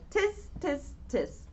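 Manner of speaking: soft mocking tsk-tsk; teasing monkeys. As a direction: tut back at him cheekily. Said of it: "the monkeys only shook their fingers back and said".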